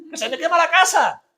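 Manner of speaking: high alarmed cry of panic; panicked shout. Retold or imitated. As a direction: yell it like your house is burning. Imagined panic at burning bush.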